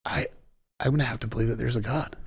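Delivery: soft, hesitant admission after a long pause; understated, hushed realization; retold quiet confession. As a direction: imitate someone conceding it softly.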